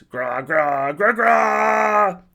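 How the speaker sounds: loud, high troll shouts, building in pitch; troll roar. A narrator's reading. Troll answering among the soldiers, roaring loudly. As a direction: bellow each syllable, stretching the last one out. Troll bellowing beside its captive.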